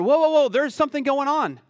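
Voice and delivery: startled realization, played up; alarmed reaction. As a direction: react as if something strange is happening.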